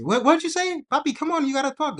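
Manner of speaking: loud, very high shouted demand; hammed-up counterman hollering over the counter; impatient deli worker. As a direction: hurry the customer along at a high-pitched yell. Impatient deli worker rushing a customer.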